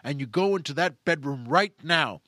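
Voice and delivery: emphatic orders bursting into shouts; acted furious commanding; madder and madder. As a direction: drive each command home, then explode. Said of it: "make the guy madder and madder".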